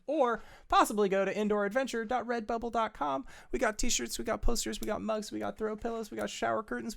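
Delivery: high, fast, excited ad read; hyped merch plug. Acted. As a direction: sell the merch with big energy. Merch plug during the break.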